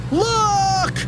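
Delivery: very high squealed call; long, high cartoon cry; alarmed shout. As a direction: squeal a warning to your friend. Boy points up at danger.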